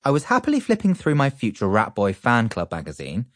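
ordinary delivery

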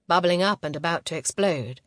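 ordinary delivery

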